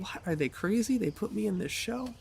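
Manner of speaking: high-pitched, incredulous inner voice; incredulous disbelief. Retold or imitated. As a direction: question it in rising disbelief. Retold disbelief at being cast.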